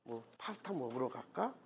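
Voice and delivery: very soft, low, coaxing voice; soothing boyfriend. Pretend. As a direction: gently placate a crying girlfriend, barely above a murmur. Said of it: "남자가 되려 화내는 게 아니라".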